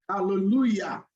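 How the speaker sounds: ordinary talk, nothing marked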